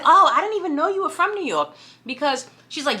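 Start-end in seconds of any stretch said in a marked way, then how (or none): 0.0-1.7 higher-pitched retold surprise; mock surprise. Interviewer reacting to candidate's hidden New York roots, imitated surprise.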